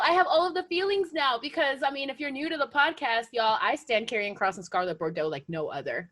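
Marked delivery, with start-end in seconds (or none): none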